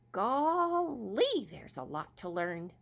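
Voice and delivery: high amazed squirrel voice. Acted. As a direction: gasp at all the learning. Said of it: "said the squirrel".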